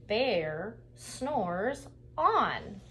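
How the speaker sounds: very slow, stretched with weight on on; drawn-out refrain. Acted. Narrator reading the refrain to children, very slow. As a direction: savor the refrain so kids join in.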